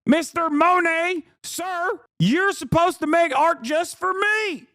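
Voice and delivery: high, whiny mock indignation; entitled complainer. Mock complainer demanding Monet make art just for him, high and affronted. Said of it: "can you imagine being like".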